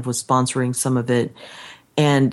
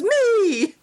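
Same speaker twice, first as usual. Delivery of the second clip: gleeful reply. Retold or imitated. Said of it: "And he goes".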